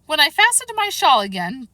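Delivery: a touch of high, pained complaint; groaned out. A narrator's reading. The poor Queen answers Alice with a mild groan. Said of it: "the poor Queen groaned out".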